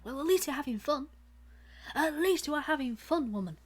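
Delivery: playful fast teasing. Pretend. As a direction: tease her, quick and bright. Teasing exclamation to friend.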